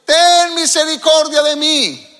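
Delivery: súplica gritada, aguda y lenta; grito del ciego. Acted. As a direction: ruega a voz en cuello. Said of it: "qué le gritaba el ciego". (el ciego suplica a Jesús, grito agudo y lento)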